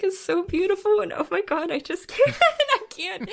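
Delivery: barely holding it together; overcome with tears. Real, not acted. Recalling the music, tearful and overwhelmed. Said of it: "I am sobbing".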